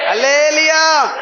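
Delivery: loud drawn-out praise call; preacher's slow loud hallelujah; slow shouted hallelujah. Real, not acted. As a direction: stretch it out to rouse the church.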